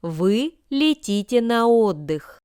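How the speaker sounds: usual speaking voice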